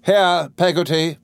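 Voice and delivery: high, drawn-out summons; calling out. A narrator's reading. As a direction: call the servant through the door. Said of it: "cried Miss Betsy, opening the parlour door".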